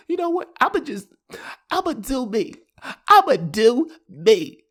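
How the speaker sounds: high-pitched mock girlfriend declaring independence; quarrelling girlfriend. Girlfriend telling her man she's done, mock defiant. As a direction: imitate a fed-up girlfriend, pitched well up.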